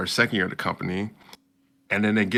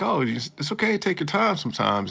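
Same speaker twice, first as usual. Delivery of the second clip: kind boss; 8 semitones higher than usual. Retold or imitated. Voicing the boss's reply.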